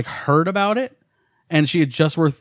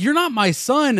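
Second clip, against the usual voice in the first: high, insistent denial of his son; stern father; 9 semitones higher than usual. Retold or imitated. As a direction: reject him like a shaken father. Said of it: "He's like".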